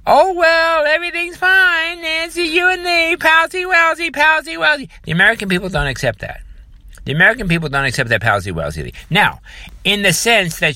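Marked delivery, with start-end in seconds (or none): 0.1-4.9 mock falsetto, way up high; high mocking sing-song of fake friendliness; mocking put-on voice. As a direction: ham up a chummy pretend-everything's-fine voice, high. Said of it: "now he's supposed to".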